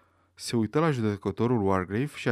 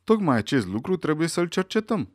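judge's firm intervention, higher pitch; authoritative; 6 semitones higher, 4 dB louder than usual. A narrator's reading. Judge steps in to direct the inquiry, authoritatively. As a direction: take charge with mild authority. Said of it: "vorbind cu autoritatea pe care i-o dădea experiența".

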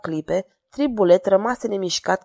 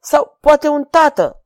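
slow, louder, higher pleading tremble; a father's fear breaking through, slowed down; trembling plea from the jester to the king; 7 semitones higher, 6 dB louder, slower than usual. Acted. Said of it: "pe un ton tremurător".

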